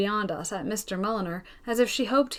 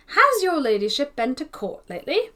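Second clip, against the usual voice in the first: put-on polite old lady; 5 semitones higher, 4 dB louder than usual. Miss Pole addresses the peeress.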